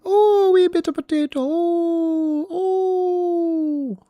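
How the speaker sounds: piercing falsetto mock-Irish wail; Irish accent. Shown as a demonstration. Podcaster showing off his stolen Irish accent, falsetto mock Irish. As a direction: sing out a silly Irish accent, very high and loud. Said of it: "How do you think I learned Irish accent?".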